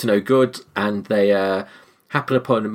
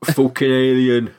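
mock film line; slower than usual.

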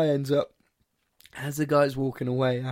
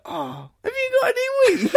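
very high, eager mock plea; high-pitched pestering impression; Sean; 3 dB louder than usual. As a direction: imitate a drunk pal pestering. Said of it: "and then Sean goes".

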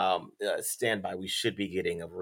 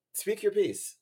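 higher, louder mock-grand announcing; 13 semitones higher, 4 dB louder than usual. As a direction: call out the invitation. Host prompting caller theatrically.

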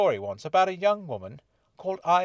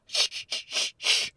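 exaggerated hissed shushing; hammed-up whispered shh; theatrical hiss; slower than usual. A narrator's reading. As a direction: shush them with a stagey hiss. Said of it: "he hissed theatrically".

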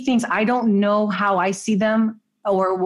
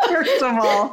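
laughing; 4 semitones higher than usual. Real, not acted. Guest laughing into reply.

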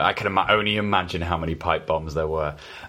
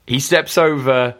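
wrestling announcer; 3 semitones higher than usual. Acted. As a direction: call the entrance like a ring announcer. Reads wrestling entrance hyped.

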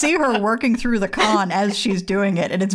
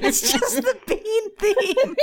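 laughing; 11 semitones higher, slower than usual. Real, not acted. Giggling over the name.

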